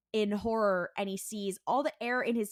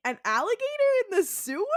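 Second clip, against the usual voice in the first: high, loud disbelieving squeak; mock-incredulous Dean; incredulous scoff; 12 semitones higher, 4 dB louder than usual. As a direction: scoff at the idea in a pitched-up voice. Dean scoffs at alligator theory.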